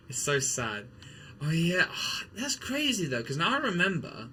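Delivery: ordinary talk, nothing marked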